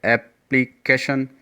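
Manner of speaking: एप्लीकेशन loud, in parts, ऐप-प्ली-केशन; split into syllables. Shown as a demonstration. Teacher recapping four syllables, एप्लीकेशन loudly broken up. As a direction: punch out each piece of एप्लीकेशन. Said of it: "four syllable हमने देखा है".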